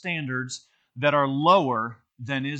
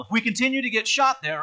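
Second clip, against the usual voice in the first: higher-pitched, half-hearted Scottish impression; bad Scottish accent; 8 semitones higher, 4 dB louder than usual. Scotty warns the crew under fire, rough Scottish accent. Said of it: "that's not a very good Scottish accent".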